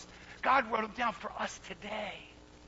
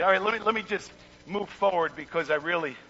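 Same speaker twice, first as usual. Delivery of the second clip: ordinary talk, nothing marked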